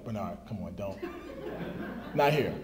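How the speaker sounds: imitating the wife shushing him in public; hushing wife.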